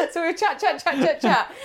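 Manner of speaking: usual speaking voice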